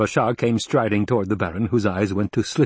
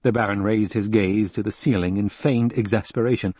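ordinary delivery, nothing marked

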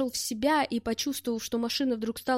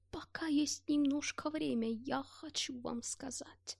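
faint rasping whisper; a dying man's breathless whisper; hoarse whisper; 4 semitones higher, 9 dB quieter, slower than usual. Acted. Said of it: "прохрипел лежащий рядом Гельмут". (хриплый шёпот)

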